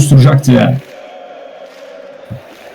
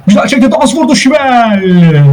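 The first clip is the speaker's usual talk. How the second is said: excited save call; 9 semitones higher than usual. Real, not acted. Commentator calling Dans's shot and Şivel's save, excited. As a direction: call the shot high, then stretch the keeper's name.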